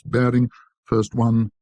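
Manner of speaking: ordinary delivery, nothing marked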